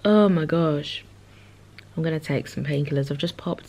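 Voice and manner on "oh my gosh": loud pained groan. Real, not acted. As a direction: moan it from a headache. Headache groan.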